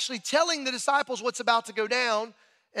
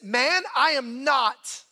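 slow, raised, louder acted denial; forceful denial; 4 semitones higher, 5 dB louder, much slower than usual. Peter denying he is one of them, forceful. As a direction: land each word hard and slowly.

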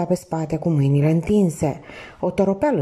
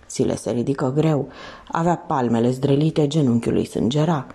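ordinary delivery

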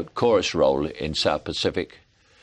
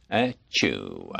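low, dignified sneeze; Gregory Peck sneeze; 11 semitones lower, 3 dB quieter than usual. Retold or imitated. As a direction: sneeze like a stately film star. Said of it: "Gregory Peck sneezing".

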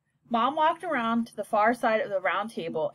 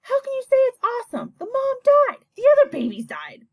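much higher, louder and fast, appalled; upset outburst; 14 semitones higher, 7 dB louder than usual. Acted. Sister reproaching brother over the dead mother skunk, upset and loud. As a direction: hurl it at him in disbelief.

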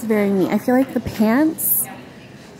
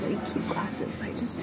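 whisper; 8 dB quieter than usual. Real, not acted. Hushed aside about drinking.

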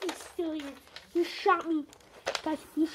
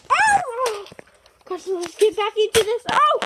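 excited yell; 6 semitones higher, 11 dB louder, slower than usual. Real, not acted. Getting shot at.

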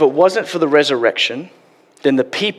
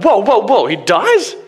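high, louder, startled mock gasp of a character; shocked disbelief; 6 semitones higher, 3 dB louder, slower than usual. Reading group member hearing Jesus dies, mock shocked. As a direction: play the shocked newcomer to the story.

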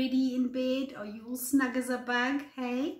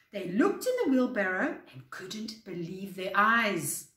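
plain reading voice

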